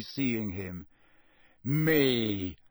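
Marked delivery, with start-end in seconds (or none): 1.6-2.6 grim. A narrator's reading. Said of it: "said Moody grimly".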